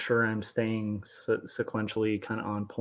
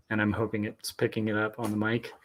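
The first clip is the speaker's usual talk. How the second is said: ordinary delivery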